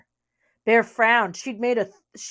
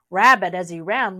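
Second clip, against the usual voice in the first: usual speaking voice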